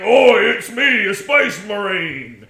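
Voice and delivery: slow, high, loud dopey orc; a sneaky orc faking it; dim orc voice. Retold or imitated. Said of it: "holding up a crudely drawn picture ... and saying".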